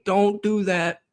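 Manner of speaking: talking at the TV. Retold or imitated. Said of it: "you're talking to your T V and you're saying".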